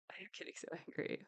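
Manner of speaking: fast unvoiced whisper; whispered anger. Real, not acted. Repeating to viewers in a rushed whisper. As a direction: spit it out under your breath.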